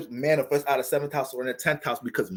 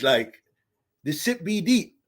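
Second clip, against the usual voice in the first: ordinary talk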